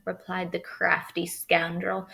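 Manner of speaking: plain reading voice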